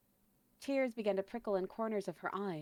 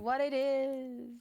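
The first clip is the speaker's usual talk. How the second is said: slow drawn-out; 5 semitones higher, 5 dB louder, much slower than usual. Pretend. Meme line drawn out.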